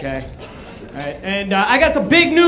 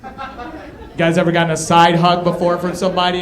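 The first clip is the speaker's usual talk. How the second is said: ordinary talk, nothing marked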